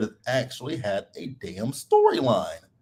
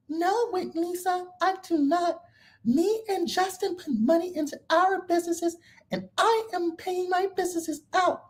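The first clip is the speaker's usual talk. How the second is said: way higher squeaky imitation of Whitney; mock her in a much higher voice; 4 dB quieter than usual. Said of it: "Whitney quoted in story".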